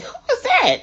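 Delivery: high, startled laughing question; surprised. Retold or imitated. Wife startled by a heavy knock at the door, laughing surprise. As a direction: jump at the sudden knock.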